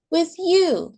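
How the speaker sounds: put-on high, whining child's voice; a toddler who won't give in; insistent child. A narrator's reading. Maylee pleading with her mom, high whiny voice.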